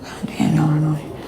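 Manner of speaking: a whispered "God damn,"; exasperated whisper. Real, not acted. Annoyed at the chat, a whispered curse. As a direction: breathe it out, fed up.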